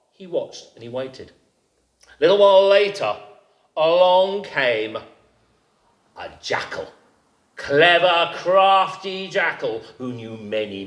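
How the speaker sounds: ordinary delivery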